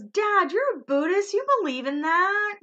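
imitating Kristen. Kristen teasing her Buddhist dad, high and loud. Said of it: "And Kristen goes".

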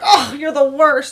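put-on teen son gloating loudly at dad; mock taunt. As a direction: gloat at your dad over the game. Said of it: "he'll be like".

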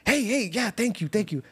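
high, chirpy imitation of a bubbly guy; bubbly blind man. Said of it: "the most bubbly guy in the world".

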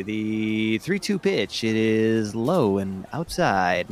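slow, drawn-out play-by-play call; baseball announcer voice. Shown as a demonstration. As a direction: call the pitch like a ballgame broadcaster. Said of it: "Give me the baseball voice".

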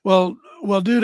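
ordinary talk

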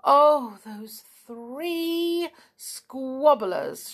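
loud, drawn-out exasperated complaint; complaining. A narrator's reading. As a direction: groan about them with long, high stretched words. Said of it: "She complained about the quarrelling".

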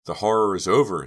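elated, grateful outburst; grateful relief. A narrator's reading. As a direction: exclaim it with thankful release. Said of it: "Dina thought gratefully".

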